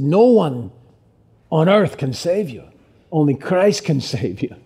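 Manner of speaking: put-on Irish accent for a quote. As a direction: quote him with an Irish lilt. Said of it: "why am I still in the accent?".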